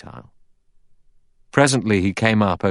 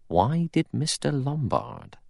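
slow, high "Why did Mister Lombard", softly insinuating; pointed question; 8 semitones higher, 5 dB quieter, slower than usual. A narrator's reading. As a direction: stretch "Why did Mister Lombard" slowly, pitched up then falling.

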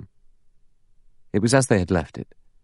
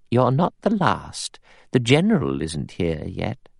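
prim guest; 4 semitones higher than usual. A narrator's reading. Prim older guest answers the latecomer.

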